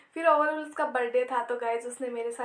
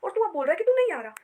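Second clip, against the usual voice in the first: higher-pitched indignant protest; retelling an angry scolding, voice raised in pitch; retold anger; 4 semitones higher, 4 dB louder than usual. Sister scolding brother for backing out late at night, indignant. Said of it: "अब मुझे इतना गुस्सा आया".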